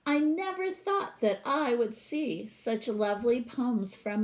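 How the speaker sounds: lively read-aloud storytelling voice; storybook reading. Acted. Teacher reading a picture book to her class, expressive read-aloud.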